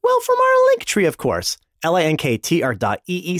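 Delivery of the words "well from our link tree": very high falsetto; squeaky mock answer. Host answers listener's question about links, squeaky falsetto.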